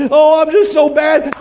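high, loud, mock-pitiful moan; hammed-up self-pity; mock sheepish whine. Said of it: "like we're sheepish".

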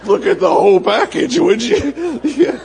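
playful vain chuckle; laughing vain boast. Retold or imitated. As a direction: show off your own package with a giggle.

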